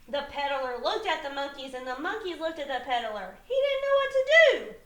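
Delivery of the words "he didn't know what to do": high, loud, quick narration; animated dismay. A narrator's reading. Teacher telling children of the helpless peddler, animated dismay. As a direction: blurt it out in storybook alarm.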